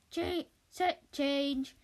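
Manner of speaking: slow, drawn-out announcement; slow call. Acted. Crew calling a set change, slow. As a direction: call it out slowly.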